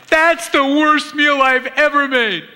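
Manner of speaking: high, slow, wailing self-pity; wife blurting out at the dinner table in a high, slow wail; wife blurts out. Retold or imitated. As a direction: wail it like a cook who thinks she ruined dinner. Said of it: "she blurts out".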